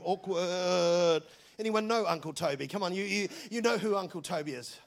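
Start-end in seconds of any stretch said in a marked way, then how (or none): 0.0-1.2 awkward. Acted. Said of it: "everybody is going".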